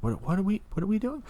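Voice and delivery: high, bewildered what-are-we-doing; sudden confusion at a child; baffled. Retold or imitated. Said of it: "I'm like".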